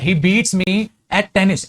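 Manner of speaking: very slow, drawn-out English; slow dictation. Shown as a demonstration. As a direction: dictate slowly to the students. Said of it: "चलो". (धीरे-धीरे बोलकर लिखवाना)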